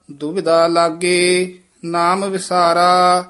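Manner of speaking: measured, sustained Gurbani recitation; scripture recitation. Shown as a demonstration. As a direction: recite phrase by phrase, holding the endings.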